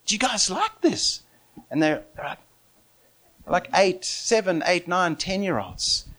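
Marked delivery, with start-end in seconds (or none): 0.1-1.2 put-on high, coaxing question to small children; playful invitation to children; talking to kids. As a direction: lift the pitch as if for little ones.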